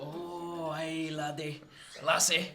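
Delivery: put-on a light Scottish accent, softly done; a gentle hint of Scottish lilt on aye laddie. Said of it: "a soft Scottish accent".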